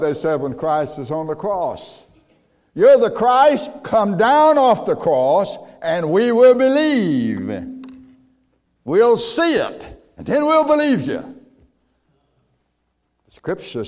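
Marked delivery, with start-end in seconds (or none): 2.7-11.4 mocking taunt. Mockers taunt Christ on cross.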